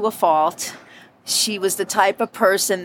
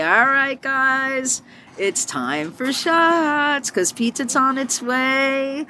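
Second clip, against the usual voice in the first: bar announcement; 6 semitones higher, much slower than usual. Retold or imitated. Bartender announcing shots to the bar.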